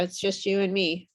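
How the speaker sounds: usual speaking voice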